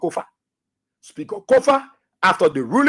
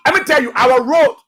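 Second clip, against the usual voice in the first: normal talking voice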